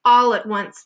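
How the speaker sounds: usual speaking voice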